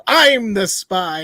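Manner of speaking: loud, drawn-out mock announcement; unsubtle spy. Mocking a spy's lack of subtlety, mock-blatant announcement. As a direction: blurt it slowly and loudly, hammed-up. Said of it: "he was not subtle".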